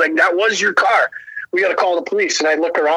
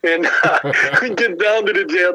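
ordinary delivery

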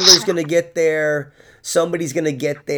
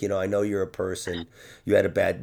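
a low, soft, weary aside; subdued and low; quiet tired voice; 8 semitones lower, 7 dB quieter, faster than usual. Retold or imitated. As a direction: speak it like a drained man. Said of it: "he's like".

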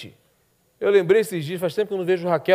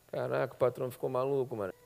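muttered under the breath, much softer; hushed aside; 5 semitones lower, 12 dB quieter than usual. Acted. Guards murmuring among themselves about the boss, hushed. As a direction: guards whispering that the boss is crazy.

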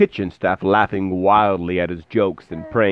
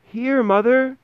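put-on very high little-boy shout; tiny Tom answering his mother from the cow; 3 dB louder, slower than usual. A narrator's reading. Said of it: "Tommy shouted".

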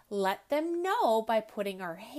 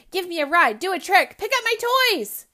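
higher, louder demands, speeding up; bossy orders; 7 semitones higher, 12 dB louder, much faster than usual. Acted. Ellie ordering her elephant around, bossy and fast. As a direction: rattle off the orders like a spoilt child.

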